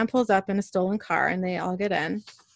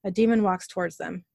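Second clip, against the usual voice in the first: normal talking voice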